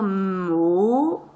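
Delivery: "mot" with a long held M and vowel; "mot" drawn out, sound sliding into sound. Shown as a demonstration. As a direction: stretch "mot" slowly like a beginning reader. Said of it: "l'enfant devra lire".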